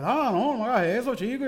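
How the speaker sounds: put-on pleading kid.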